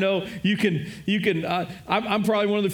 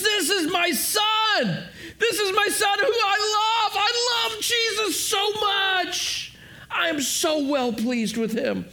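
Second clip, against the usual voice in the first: proud father's joy; 8 semitones higher, slower than usual. Retold or imitated. God proclaiming his love for his Son, overjoyed and high. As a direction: let the pride spill over as God the Father. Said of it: "And he goes".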